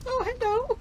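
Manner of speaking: put-on very high, squeaky baby talk to a pet; baby-talk squeak. Shown as a demonstration. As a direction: squeak it like cooing at a baby animal. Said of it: "it was like".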